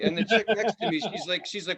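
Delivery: ordinary delivery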